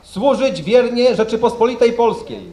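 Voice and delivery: solemn, measured oath dictation; formal oath reading. Real, not acted. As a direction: read each oath line out for the ranks. Officer reading the oath line.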